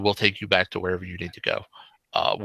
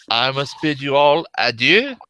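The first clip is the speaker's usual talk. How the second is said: put-on French accent; 5 semitones higher, 4 dB louder than usual. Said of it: "he says this in a very good French accent".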